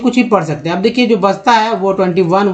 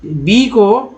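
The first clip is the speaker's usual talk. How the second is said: slow spelled letter; much slower than usual. Shown as a demonstration. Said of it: "उच्चारण क्या होता है इस बात पे फोकस करेंगे".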